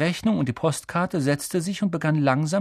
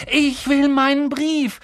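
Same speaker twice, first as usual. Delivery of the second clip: high, loud, drawn-out demand; shouted demand; 12 semitones higher, 5 dB louder, slower than usual. Acted. Boy refusing to budge, slow loud high demand. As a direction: stand your ground and yell. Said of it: "rief er".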